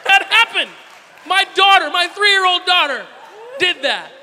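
high, loud disbelief; incredulous shouting. Real, not acted. Father amazed at his three-year-old, shouting.

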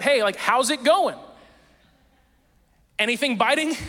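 high, loud hail across the water; yelling out. Retold or imitated. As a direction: call out to someone at a distance, imitating a friendly stranger. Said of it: "this person yells out".

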